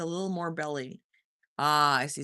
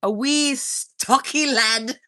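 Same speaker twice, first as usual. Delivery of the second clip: slow, louder mock Irish accent; 4 semitones higher, 8 dB louder, much slower than usual. Streamer teasing a viewer, slow mock Irish accent. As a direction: lilt it out in a hammed-up Irish accent. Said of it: "Are you Irish?".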